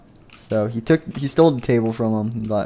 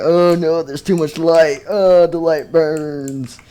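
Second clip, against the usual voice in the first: higher, louder hammed-up wail; mock agony; 5 semitones higher, 5 dB louder, slower than usual. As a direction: cry out as if burned, drawing it out at the end. Character burned by light.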